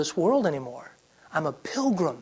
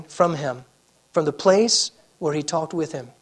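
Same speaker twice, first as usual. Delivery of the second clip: ordinary talk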